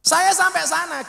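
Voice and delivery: loud, high shout to the crowd. Real, not acted. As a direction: belt it out. Shouted return to the story. (teriak keras)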